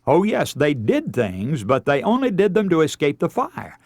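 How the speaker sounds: usual speaking voice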